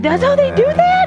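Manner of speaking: very high, incredulous question; retold disbelief. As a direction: squeak it out in mock horror. Retold disbelief at epidural procedure.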